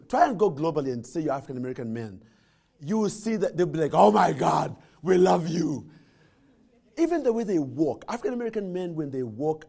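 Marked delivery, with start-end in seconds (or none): 4.0-5.9 excited fans. Retold or imitated. People abroad greeting African Americans.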